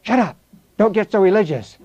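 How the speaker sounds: hammed-up annoyed brush-off; dismissive heckling. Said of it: "what do we get? You know, people".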